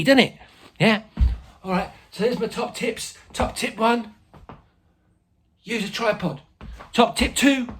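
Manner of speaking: chatty informal pupil-like presenter; Ernie. Acted. Teacher plays Ernie, a helper.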